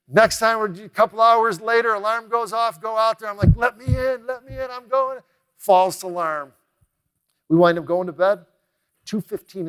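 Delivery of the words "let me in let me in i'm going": higher, mock-keen plea; hammed-up eagerness to rush in; eager bravado. Nervous guest mock-begging the firemen to let him in.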